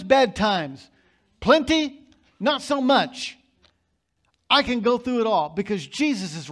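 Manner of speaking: loud, driving sermon voice, pressing every point; fiery preaching. Acted. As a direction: preach it out full force to the congregation. Preaching at full volume.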